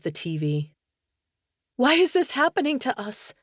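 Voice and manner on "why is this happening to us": high, anguished plea; agonized. A narrator's reading. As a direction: cry out in despair. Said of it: "She heard the agony in her voice".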